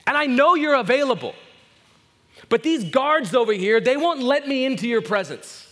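high, loud, impassioned preacher's cry; preaching at full force. Acted. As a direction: build to a full pleading sermon shout. Preaching climax, pleading story.

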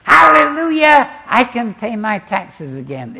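hammed-up, loud and high burst of joy; mock joyful hallelujah. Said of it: "Not many Christians I know say".

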